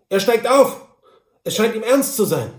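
high, loud alert to the others; calling out. A narrator's reading. Lookout at the gate calls out to the group. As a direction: call the news over to them. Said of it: "ließ er einen Pfiff hören und rief".

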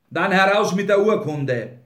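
forceful, indignant command; angry demand. A narrator's reading. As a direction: insist on it, loud and impatient. Grinley demands the document.

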